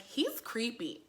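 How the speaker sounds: hushed, slow verdict into the phone; retold phone remark. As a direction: say it softly and drawn out, imitating her. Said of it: "she gets on the phone like".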